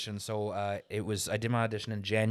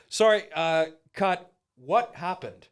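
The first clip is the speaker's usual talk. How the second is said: lightly voiced director calling cut, higher and louder; puzzled director; 7 semitones higher, 6 dB louder, slower than usual. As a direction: halt the take like a baffled director. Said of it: "the director went".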